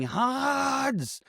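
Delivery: high, drawn-out shout held back in volume; mock scream. Retelling the hero screaming the villain's name, restrained mock scream. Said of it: "Comes down screaming".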